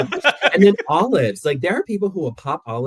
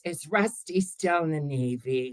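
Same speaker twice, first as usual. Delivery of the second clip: put-on slow, higher-pitched old grandma voice; 4 semitones higher, 5 dB quieter, slower than usual. Quoting the old grandma from a holiday film, slow grandma impression. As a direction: imitate a dotty grandma, drawing it out. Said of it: "the old grandma comes in".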